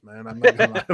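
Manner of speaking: giggly honest aside; laughing confession. Real, not acted. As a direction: admit it with a chuckle. Laughing admission.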